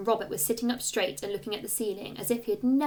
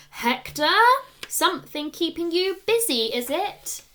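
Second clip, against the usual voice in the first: teasing teacher; 7 semitones higher, 8 dB louder than usual. A narrator's reading. Teacher catches him passing notes.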